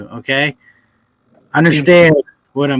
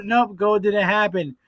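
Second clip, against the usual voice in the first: normal talking voice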